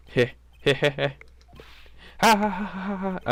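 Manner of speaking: halting hammed-up heh heh then ha ha; fake villain laugh. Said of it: "I'm really bad at laughing fakely".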